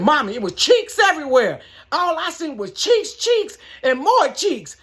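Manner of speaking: high, loud excited report; super happy. Retold or imitated. Son phoning mom about the naked lady, super happy. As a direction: gleefully hammer each cheeks, drawing out the last. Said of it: "he was, like, super happy".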